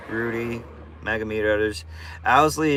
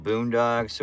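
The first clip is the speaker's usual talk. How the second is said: ordinary delivery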